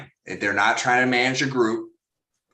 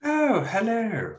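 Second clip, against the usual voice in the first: high, slow sing-song hello; drawn-out high pet-talk hello; slow high dog greeting; 11 semitones higher, slower than usual. Real, not acted. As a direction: stretch it out sweetly for the dog.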